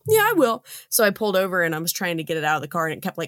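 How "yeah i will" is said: quoted reply pitched way up; breezy high "yeah, I will"; high casual quote. Retold or imitated. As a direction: toss off a high airy answer. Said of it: "I was like".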